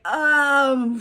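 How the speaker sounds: loud, drawn-out stalling; comic hesitation; caught out. Retold or imitated. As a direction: stall awkwardly for a cover story.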